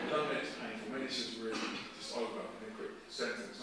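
low, subdued plea; praying to himself. Retold or imitated. Passenger begs God for no pain, praying quietly. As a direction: plead softly, just to yourself. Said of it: "just to myself".

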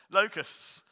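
"Locusts" hit as a firm self-correction; emphatic correction. Real, not acted. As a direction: correct yourself with a loud, high "Locusts". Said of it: "Sorry, you spotted it".

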